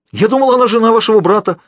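loud, very high alarmed excuse; frightened exclamation. A narrator's reading. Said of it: "испуганно воскликнул Фалуа". (испуганное громкое оправдание на высоких тонах)

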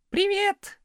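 high squeaked greeting; squeaky shout. A narrator's reading. Back-row pupils to new teacher, squeaky cheek. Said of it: "запищали с задних парт".

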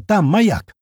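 high, urgent exclamation; yelling. A narrator's reading. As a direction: call it out urgently. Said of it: "ору я".

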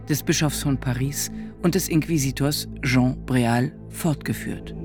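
ordinary talk, nothing marked

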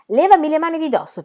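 indignant outburst, pitched high; angry demand. A narrator's reading. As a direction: demand to be let go. Brawl, man resists being held.

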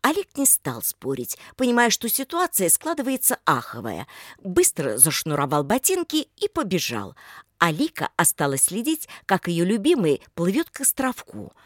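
usual speaking voice

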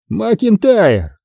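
high, drawn-out name; triumphant reveal. A narrator's reading. Boss names the informer to Carter, triumphant. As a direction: announce the culprit, stretching it high.

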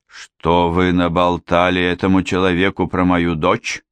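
ordinary delivery, nothing marked

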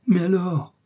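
high-pitched urgent exclamation; crying out. A narrator's reading. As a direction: burst out as the young officer. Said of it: "s'écria le jeune officier".

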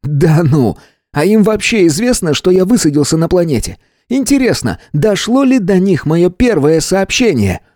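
high, overwrought voice rising to a near shriek; a paranoid man losing his grip; frantic earthling. A narrator's reading. Earthling accuses the stranger of mocking him, frantic and high.

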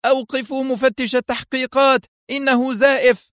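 high-pitched alarm cry; screamed alarm. A narrator's reading. Director alerts everyone over the loudspeakers, screaming. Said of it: "وهو يصرخ".

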